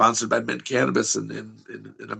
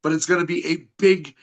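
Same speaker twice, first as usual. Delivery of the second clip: raised pitch wrestling promo voice; promo hype; 6 semitones higher than usual. Acted. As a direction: hype the shows like a ring announcer. Wrestler hyping upcoming events.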